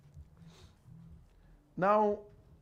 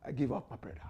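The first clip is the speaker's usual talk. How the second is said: ordinary talk, nothing marked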